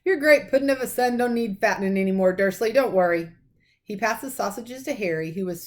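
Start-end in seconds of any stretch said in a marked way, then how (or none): 0.0-3.3 loud, rough giant voice with a dark chuckle; big booming giant, amused and scornful; a giant mocking the father, loud and dark; giant's dark voice. A narrator's reading. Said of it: "The giant chuckled darkly".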